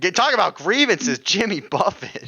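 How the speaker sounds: high, slow whiny mimic of friend; hammed-up disgusted whine; mocking imitation. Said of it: "You're like".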